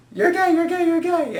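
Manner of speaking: high, teasing schoolyard chant; mocking taunt. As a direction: taunt like a playground bully.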